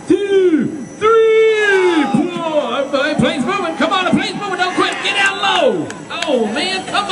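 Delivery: rally the rope team as hard as you can; shouting encouragement. Real, not acted. Emcee urging teams to pull plane.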